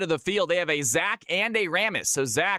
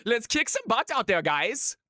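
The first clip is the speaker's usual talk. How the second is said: hammed-up Finnish accent, much higher; bad Finnish accent; 7 semitones higher than usual. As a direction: cheer the team in a mock Finnish accent. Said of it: "worst attempt at a Finnish accent".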